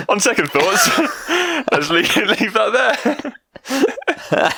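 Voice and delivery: high and slow through laughter; can barely get it out for laughing. Real, not acted. Cracking up over innuendo.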